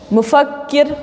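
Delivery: "मुफक्किर" split to show both k sounds; double consonant. Shown as a demonstration. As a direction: hold the doubled k in "मुफक्किर", mufak-kir. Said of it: "दो काफ बोल रही हूँ ना". ("मुफक्किर" में दो काफ साफ़ बोलकर)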